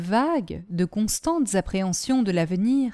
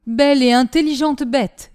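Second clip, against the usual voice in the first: loud, admiring exclamation; raised voice, higher and louder; exclaiming; 4 semitones higher, 7 dB louder than usual. A narrator's reading. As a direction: cry out in praise of the dog. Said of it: "s'écria Michu".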